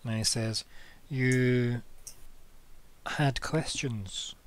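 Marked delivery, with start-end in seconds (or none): 1.1-4.3 very slow, drawn-out polite question; polite game character, softening on the last word. Acted. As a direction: draw it out with long, courteous pauses. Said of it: "So he's being polite".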